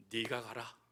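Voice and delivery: low, soft divine command; a quiet, weighty God saying go; God's voice. Acted. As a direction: speak as God, low and hushed.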